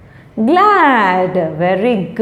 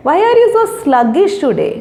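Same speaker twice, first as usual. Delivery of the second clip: mock scolding question; 7 semitones higher than usual. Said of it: "So we say that".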